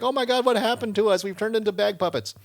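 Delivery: high-pitched mock horror; imitated puppet dismay. Transformed characters look at each other, high mock dismay. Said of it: "they all look at each other".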